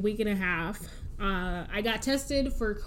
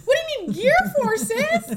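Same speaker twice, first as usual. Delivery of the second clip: very high, fast, louder shriek of disbelief; alarmed disbelief; 7 dB louder than usual. Retold or imitated. Hearing nurses through the wall, high alarmed squeal. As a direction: squeal at the word gear.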